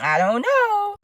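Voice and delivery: high, drawn-out sceptical tone; doubtful. Real, not acted. Storyteller weighing up Wilma's eagerness, doubtful.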